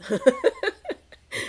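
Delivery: high, loud burst of laughter. Real, not acted. Laughing at bag mix-up.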